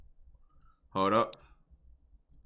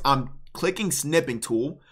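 ordinary delivery

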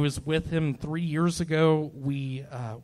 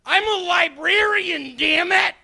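furious yell, much higher and louder; angry dad shouting; 13 semitones higher, 10 dB louder than usual. Retold or imitated. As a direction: bellow it like an enraged father. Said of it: "That's an impression of my dad".

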